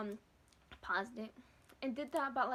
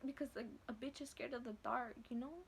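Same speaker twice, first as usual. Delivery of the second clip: ordinary talk, nothing marked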